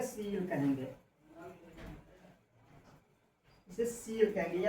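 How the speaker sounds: सील said as one short syllable, seel; teacher shows सील clearly; model pronunciation. Shown as a demonstration. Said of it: "pronunciation कैसे pronounce होगा?". (सील को साफ़ उच्चारण में)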